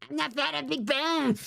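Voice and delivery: high cartoon-character voice; Cartman. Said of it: "Do a Cartman impression".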